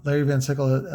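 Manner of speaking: normal talking voice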